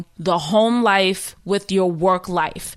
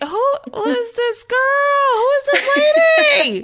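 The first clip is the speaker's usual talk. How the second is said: much higher, half-shouted disbelief; amazed at the calm new girl fixing the mess. Retold or imitated. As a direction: gasp it out, stunned by her. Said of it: "I'm like".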